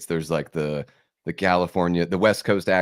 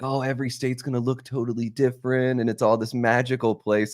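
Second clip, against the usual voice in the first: higher-pitched imagined awe; childlike wonder; 5 semitones higher than usual. Retold or imitated. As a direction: voice a naive, wide-eyed daydream. Acting out childhood wonder about states.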